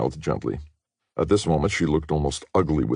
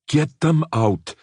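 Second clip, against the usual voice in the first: high, loud, slow hostile order; a radical woman ordering the intruders out; cold demand; 13 semitones higher, 5 dB louder, slower than usual. A narrator's reading. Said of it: "said the woman".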